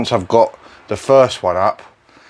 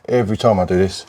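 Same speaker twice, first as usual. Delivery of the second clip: ordinary talk, nothing marked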